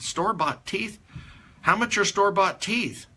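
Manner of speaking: high-pitched incredulous echo; incredulous questioning. Retold or imitated. Father questions Curly about his broken false teeth, incredulous. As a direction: repeat it back as a disbelieving question.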